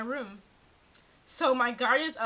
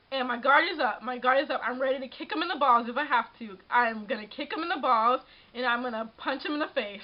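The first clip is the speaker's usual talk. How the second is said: ordinary talk, nothing marked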